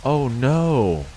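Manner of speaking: real alarm, caught off guard; shocked outburst. Real, not acted. Reacting to shocking anime scene.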